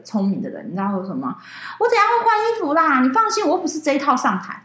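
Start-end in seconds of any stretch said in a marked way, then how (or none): 1.8-4.6 higher, breezy offhand host voice; high, dismissive imitation of the host; mock excuse. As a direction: mimic the late host brushing it off.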